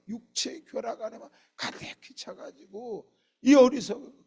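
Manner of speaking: loud, high-pitched sermon delivery; forceful preaching. Real, not acted. Preacher pressing the congregation about wisdom and greed, forceful preaching. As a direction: drive the point home at full force.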